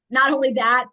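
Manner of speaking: ordinary talk, nothing marked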